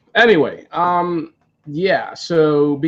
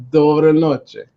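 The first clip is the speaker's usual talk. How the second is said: put-on careful Russian-accented "Dobre noche"; Russian greeting; 3 semitones higher, 4 dB louder than usual. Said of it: "I said, uh".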